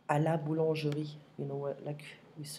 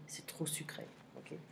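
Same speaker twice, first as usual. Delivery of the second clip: 1 whisper; 12 dB quieter than usual. Real, not acted. Whispered before nose blow.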